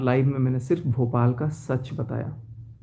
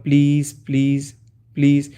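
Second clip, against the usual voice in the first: pleading; 4 dB louder than usual. Retold or imitated. Begging fiancée in retold chat.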